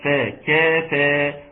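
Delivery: "فا" then "كافا" with thin, fronted vowels; light F and K. Shown as a demonstration. Teacher shows tarqiq to students, slow thin "كافا". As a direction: draw out "كافا" slowly, keeping both syllables light. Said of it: "ترقيق الفاء".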